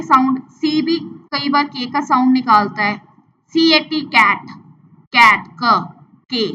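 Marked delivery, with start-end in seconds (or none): 5.6-5.9 "क" as a lone short k sound; isolated letter sound. Shown as a demonstration. Teacher isolating the sound in cat, "क" said alone. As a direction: say just the opening sound of cat, "क". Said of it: "का sound है ना".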